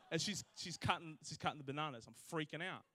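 ordinary talk, nothing marked

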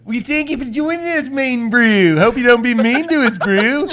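high, loud, hammed-up Kiwi accent; mock Kiwi accent. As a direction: overdo a squeaky New Zealand accent. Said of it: "haven't been working on your accent".

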